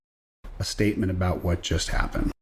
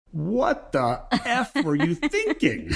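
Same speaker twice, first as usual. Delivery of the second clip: high, slow, laughing question; host laughing at the guest's story, high and slow; laughing disbelief; 8 semitones higher, much slower than usual. Real, not acted.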